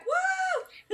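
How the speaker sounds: high cheering whoop; cheering woo. Real, not acted. Fan cheering that her favorite member returned, excited woo. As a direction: celebrate his comeback.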